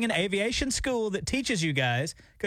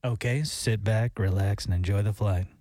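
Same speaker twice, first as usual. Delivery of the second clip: low, slow pilot-on-the-intercom voice; airline pilot announcement; 9 semitones lower than usual. Acted. Host imitating pilots for the co-host, in a low pilot voice. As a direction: drop deep and slow like a relaxed pilot. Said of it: "understand when a pilot talks is".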